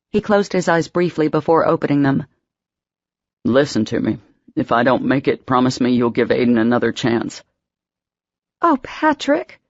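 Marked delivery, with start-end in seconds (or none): 3.4-7.4 low, weak voice of a dying man; weak old man voice. A narrator's reading. 8.6-9.6 high, pained moan of his name. A narrator's reading. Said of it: "she moaned".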